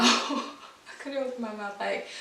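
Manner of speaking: laughing through the words; a laugh over the line. Real, not acted. Laughs recalling she couldn't eat.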